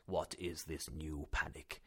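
low, slow, unruffled; calm. Acted. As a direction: calmly dismiss the alarm. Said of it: "he answered surprisingly calmly".

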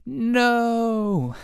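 hammed-up, drawn-out high loud "no"; sickly scream. Author reading his eighth-grade story, melodramatic sickly scream. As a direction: melodramatic wail, pitched high and held long. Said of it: "screamed in a sickly voice".

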